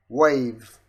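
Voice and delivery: loud, firm one-word command. Shown as a demonstration. Saying wave to the software, loud voice command. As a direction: call it out for a machine to catch.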